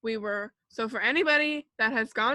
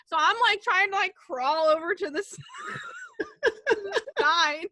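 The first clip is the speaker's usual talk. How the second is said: laughing; 9 semitones higher than usual. Real, not acted. Laughing while telling the fall.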